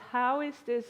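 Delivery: ordinary delivery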